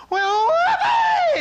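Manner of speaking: very high, long, loud whoop; screamed whoopee. Retold or imitated. Master throwing himself through waterfall, retold exultant scream. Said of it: "screams, throws his body".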